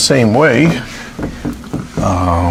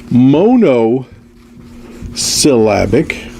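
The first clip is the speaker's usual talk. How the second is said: drawn-out word; much slower than usual. Shown as a demonstration. Lecturer writing example on board.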